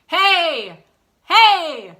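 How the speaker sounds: big connected shout from the belly; nice shout. Shown as a demonstration. Voice teacher demonstrating a shout. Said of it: "a nice shout".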